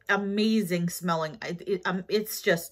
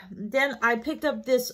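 ordinary talk, nothing marked

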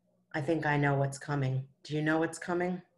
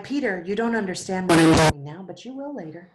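firm Jesus; 10 dB louder than usual. Acted. Jesus answers Peter firmly.

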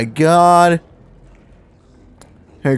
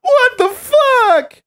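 imitating a shrill scream; mock scream; much slower than usual. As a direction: ham up a high shriek. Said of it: "Your what the fuck is what scared me".

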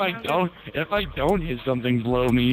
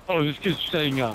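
normal talking voice

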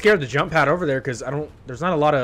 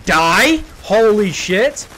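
loud stunned disbelief; shocked outburst; 6 semitones higher, 9 dB louder, much slower than usual. Real, not acted. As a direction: blurt it out in shock. Shocked after dying.

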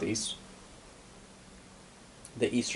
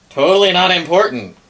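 loud, high mock dismissal; sarcastic; 9 semitones higher, 11 dB louder than usual. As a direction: wink at the players with it. Ironic aside about future capital.

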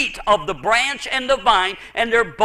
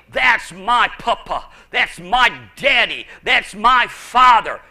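slow, emphatic claim building louder to "father"; bold emphasis; 3 dB louder than usual. Acted. Preacher voicing children claiming their father, bold emphasis. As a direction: lean on "my" each time, boldly. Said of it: "can say it with emphasis".

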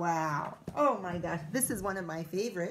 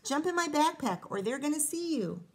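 urgent coaxing; 6 semitones higher, 3 dB louder than usual. Acted. Rosa urging Piggy.

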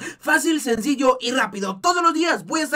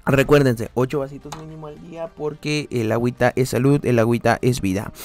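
preachy deep voice; 9 semitones lower, 4 dB louder than usual. Acted. Mock sermon about drinking water.

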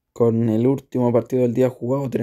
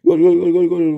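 fast, high, loud goal call; breakneck goal commentary; 5 semitones higher, 4 dB louder, faster than usual. Acted. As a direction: build to a roaring goal shout. Counterattack ends in goal.

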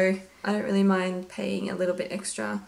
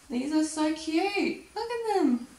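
gushing; 8 semitones higher than usual. Real, not acted. Gushing over cute koala print.

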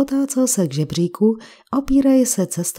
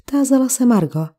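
plain reading voice

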